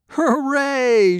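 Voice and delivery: loud, high cheer; cheering shout. A narrator's reading. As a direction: shout it out in celebration. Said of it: "shouted the animals".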